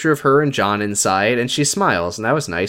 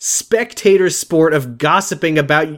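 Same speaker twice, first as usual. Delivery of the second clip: mock indignation; 5 semitones higher than usual. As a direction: act out an outraged reproach. Acting out Lauren's scolding.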